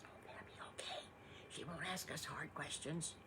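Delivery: hushed giggly aside to his brother; whisper. Acted. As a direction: whisper it so Mommy won't hear. Piglet snickers a secret to brother.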